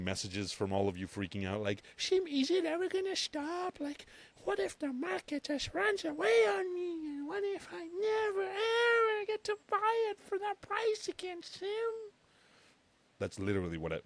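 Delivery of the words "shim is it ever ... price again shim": put-on very high whiny baby voice, slow at the end. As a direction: read the text like a whimpering baby. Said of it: "that's how I read your texts".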